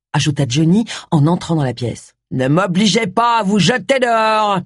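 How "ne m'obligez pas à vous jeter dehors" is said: raised, threatening warning in a put-on voice; menacing bodyguard. Bodyguard ordering visitors out of the room, menacingly. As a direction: threaten them like a heavy.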